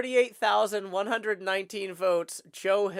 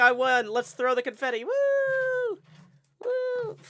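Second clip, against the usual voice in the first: excited cheering; slower than usual. Retold or imitated. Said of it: "people are saying".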